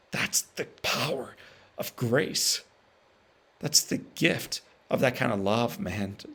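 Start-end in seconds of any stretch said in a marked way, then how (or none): 0.1-4.6 hushed, slow awe at grace; reverent whisper. Real, not acted. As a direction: breathe it out slowly for weight. Hushed climax of the point.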